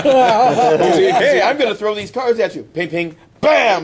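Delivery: high, laughing taunt with sound effects; cocky Gambit taunt. Retold or imitated. Host acting out Gambit mocking Captain America, high and gleeful. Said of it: "Gambit is far, far away".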